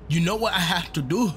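badly faked Scottish accent, rising question. Gamer retrying the line in a mock Scottish accent. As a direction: attempt a Scottish accent, barely holding it. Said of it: "I can't do a Scottish accent".

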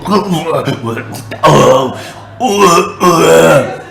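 slow, drawn-out mock giant bellow; giant's roar. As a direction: roar like a lumbering giant, stretching each cry. Goliath meets David, giant's noises.

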